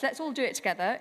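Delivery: usual speaking voice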